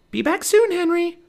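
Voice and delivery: put-on high, loud girlish call. A narrator's reading. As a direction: call a goodbye to a friend. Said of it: "she called".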